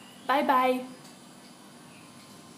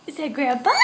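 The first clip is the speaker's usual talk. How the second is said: laughing; 5 semitones higher, 5 dB louder than usual. Real, not acted. Parent giggling at toddler.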